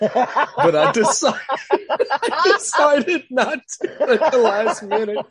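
high, loud words through real laughter; laughing hard. Real, not acted. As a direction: crack up while confessing, slowed by laughs. Cracks up confessing his bluff.

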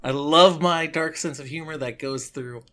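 ordinary talk, nothing marked

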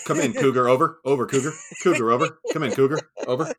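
loud radio call, laughing by the end; mock radio call. Co-host parodying the pilot's radio call, loud. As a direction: call him over the radio.

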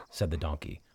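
usual speaking voice

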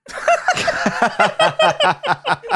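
very high cackling laugh; high giggle. Real, not acted. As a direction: giggle at your own joke. Laughs at own pitch.

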